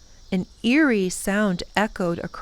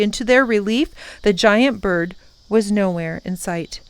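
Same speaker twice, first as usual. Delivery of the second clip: ordinary delivery, nothing marked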